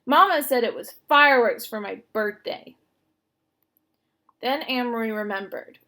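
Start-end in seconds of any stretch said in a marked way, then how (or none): 0.0-2.8 loud, defiant telling; insistent. A narrator's reading. Little sister insisting she saw the fireworks, loud. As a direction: prove she is right, loudly.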